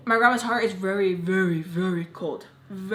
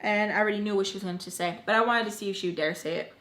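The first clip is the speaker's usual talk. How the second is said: ordinary delivery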